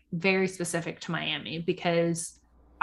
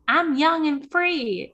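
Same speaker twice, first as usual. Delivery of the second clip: mocking party girl; 9 semitones higher, 4 dB louder, much slower than usual. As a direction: drag it out like a giddy tipsy girl. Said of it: "She was like".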